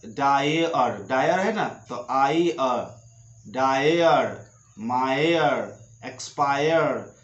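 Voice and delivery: slow, careful डायर, मायर, डिजायर with आई अ ending; teacher drilling -ire words slowly; word list demo. Shown as a demonstration. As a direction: read each word like a pronunciation drill. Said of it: "जैसे यहाँ पर डायर".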